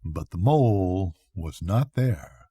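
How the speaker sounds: usual speaking voice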